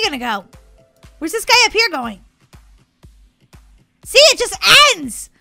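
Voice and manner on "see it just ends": higher, louder outburst; indignant exclamation. Real, not acted. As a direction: exclaim it, proved right and outraged. Proved right about course ending.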